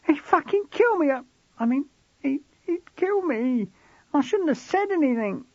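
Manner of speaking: put-on high, stammering scared boy; frightened teen boy. A narrator's reading. Voices frightened George.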